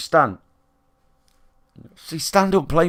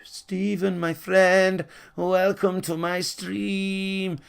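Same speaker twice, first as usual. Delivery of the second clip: higher, very slow syrupy welcome; mocking fake streamer; 6 semitones higher, much slower than usual. Fake streamer welcoming a kid: high, very slow syrupy greeting. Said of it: "they're like".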